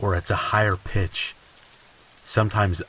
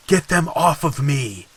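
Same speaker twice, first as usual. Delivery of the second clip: high, loud panicked cry; mock scream; 8 semitones higher, 6 dB louder than usual. Husband yelling in his sleep, loud and panicked. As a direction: yell it like he's fighting something off. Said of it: "repeatedly screamed".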